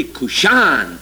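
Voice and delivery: Cushan said with the stress on -shan; Cushan, accent on the final syllable, mock-classy; stress on last syllable. Shown as a demonstration. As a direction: say Cushan as kush-AHN, slow and deliberate. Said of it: "The accent's always on the last syllable".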